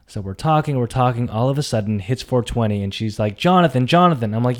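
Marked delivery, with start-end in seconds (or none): 3.4-4.4 raised, urgent retold call; imitating an insistent caller; calling his name. Retold caller alerting him on phone.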